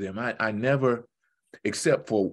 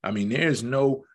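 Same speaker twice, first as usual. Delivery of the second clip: ordinary delivery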